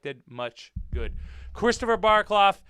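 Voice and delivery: ordinary talk, nothing marked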